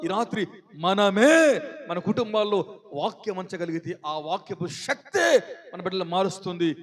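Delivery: ordinary talk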